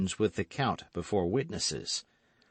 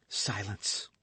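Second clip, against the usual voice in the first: urgent whisper; 6 dB quieter than usual. Acted. Sudden warning, whispered.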